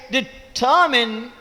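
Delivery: "Determine" with the stress on the second syllable, drawn out slowly. Shown as a demonstration. A lecturer showing students where the stress falls in "Determine". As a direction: stretch out "Determine", leaning on "ter". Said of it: "second syllable stress".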